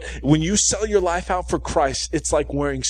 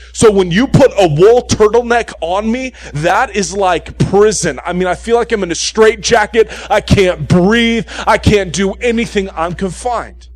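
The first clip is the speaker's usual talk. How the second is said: louder and higher, building exasperation; rising exasperated rant; 4 semitones higher, 6 dB louder than usual. Real, not acted. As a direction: preach it like you're suffocating in the sweater. Preacher's wool turtleneck rant.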